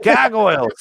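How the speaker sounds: high Boston voice saying "Gargoyles" with no R; Boston accent, dropped R. Retold or imitated. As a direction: mimic the expo guy's Boston accent. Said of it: "And he was like".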